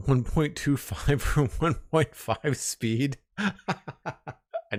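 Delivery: real amused laughter breaking into the words; laughing. Real, not acted. Streamer amused by viewer watching vods sped up, laughing. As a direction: talk through a chuckle, then laugh.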